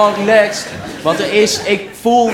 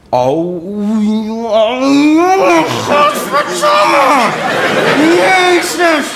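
indignant prince rant; 9 semitones higher than usual. Acted. Spoiled prince raging at the girl who rejected him, shouting high. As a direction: rant like an outraged royal brat who has just been turned down.

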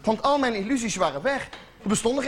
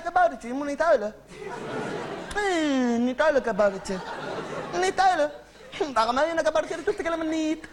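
cooing comfort; 3 semitones higher than usual. Acted. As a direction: hush him like a crying toddler. Comforting the crying gnome.

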